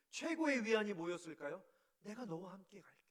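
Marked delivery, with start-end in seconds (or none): 2.0-3.0 soft, hushed reassurance; tender promise. Acted. God reassuring fearful Moses, soft and tender. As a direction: let the words settle gently. God's comforting answer.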